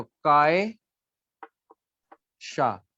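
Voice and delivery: कायशा in two long, drawn-out syllables; spelling out slowly. Shown as a demonstration. Teacher showing a student how to write कायशा, slowly syllable by syllable. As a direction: say कायशा very slowly, pausing between काय and शा. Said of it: "कायशा Punjabi विच कैसे लिखेंगे".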